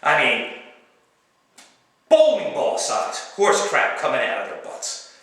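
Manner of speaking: normal talking voice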